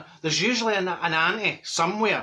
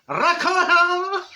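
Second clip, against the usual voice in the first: high, slow Ricotta; comic high Ricotta; drawn-out cheese answer; 11 semitones higher, 5 dB louder, much slower than usual. Retold or imitated. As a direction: stretch Ricotta for the camera. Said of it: "And I'm like".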